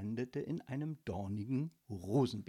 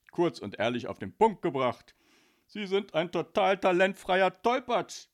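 sneering letter writer; 8 dB louder than usual. Acted. Reads a sneering rejection letter.

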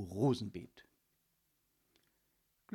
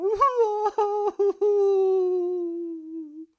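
high, loud drawn-out howl of crying; loud wailing howl; 15 dB louder than usual. Acted. Ghost howling with grief, even louder. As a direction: howl like a heartbroken child. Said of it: "heulte nur noch lauter".

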